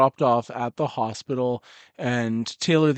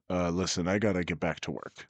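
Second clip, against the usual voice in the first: gruff lower voice for the orc, keen to leave; 6 semitones lower, 6 dB quieter than usual. Acted. Orc leaving the hotel, says goodbye in a low voice. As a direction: drop low and brush him off as the orc. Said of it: "and he says".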